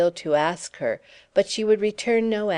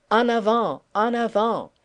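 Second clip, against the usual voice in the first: rising French shout; rally like a mad soldier, in French; French battle cry; 4 semitones higher than usual. A narrator's reading. Madman cheering in French.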